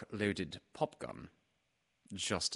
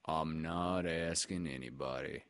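Eeyore; 3 semitones lower than usual. Acted. Eeyore objects to the expedition, gloomy. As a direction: drag it out, weary and low.

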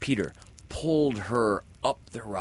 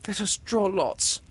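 put-on high, brave girl voice; steady courage; girl offering bravely; 13 semitones higher, 6 dB louder than usual. A narrator's reading. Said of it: "Windy said bravely".

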